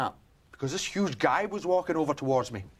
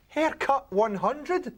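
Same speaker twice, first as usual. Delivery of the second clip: scornful; 9 semitones higher, much slower than usual. Real, not acted. Mocking the club's music.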